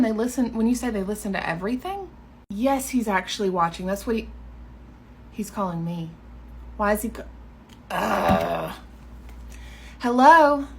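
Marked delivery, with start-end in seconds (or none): none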